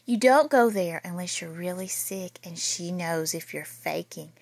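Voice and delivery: plain reading voice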